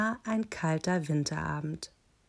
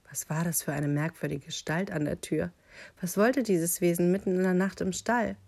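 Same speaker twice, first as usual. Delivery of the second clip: ordinary delivery, nothing marked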